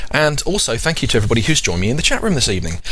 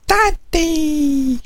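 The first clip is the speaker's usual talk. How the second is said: put-on much higher, squeaky baby call; baby voice calling out to her father; baby calling daddy. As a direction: squeal it like a toddler seeing dad. Baby calling dad at car.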